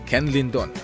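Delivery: ordinary delivery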